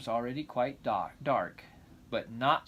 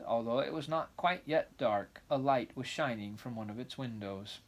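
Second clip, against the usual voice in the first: usual speaking voice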